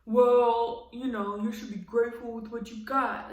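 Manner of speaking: hammed-up condescending dude; mocking him. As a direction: mimic a self-satisfied boyfriend. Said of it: "He's like".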